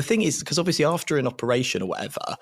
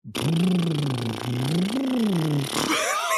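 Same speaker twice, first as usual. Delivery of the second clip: breathing demo; 6 semitones higher than usual. Retold or imitated. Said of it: "What you wanna do is go".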